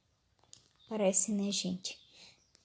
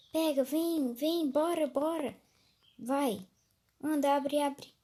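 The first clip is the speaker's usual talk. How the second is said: urgent loud urging; 4 semitones higher, 6 dB louder than usual. Real, not acted. Urging teammate to open door.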